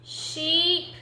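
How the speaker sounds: high, drawn-out kitten sigh; high, weary kitten voice. Acted. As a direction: sigh it as a discouraged little kitten. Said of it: "she sighed".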